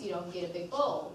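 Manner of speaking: ordinary talk, nothing marked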